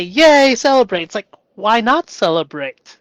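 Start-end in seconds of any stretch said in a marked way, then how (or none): 0.0-1.0 high, loud celebration; loud cheery yay. Retold or imitated. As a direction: cheer it like a win. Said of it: "If they had a bad day".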